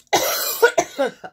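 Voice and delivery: harsh, loud choking cough fit. Real, not acted. Reader choking on spit mid reading, violent cough. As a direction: hack it out like spit went down wrong. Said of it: "I choked on my own spit".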